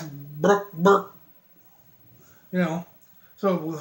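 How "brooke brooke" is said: low squeezed fart sound, then a lighter one; fart-like word. Retold or imitated. Man talking out his ass again, fart-like voice. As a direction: blurt the name out like gas escaping. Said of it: "that noise came out of his ass".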